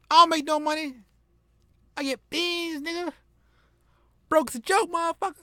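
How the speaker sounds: high, loud, cracking character voice; character voice breaking. Acted. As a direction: push the voice up until it breaks. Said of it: "I broke character really hard".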